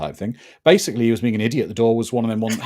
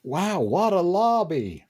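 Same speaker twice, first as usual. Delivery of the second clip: mock awe; 7 semitones higher, much slower than usual. Mock awe at game lobby.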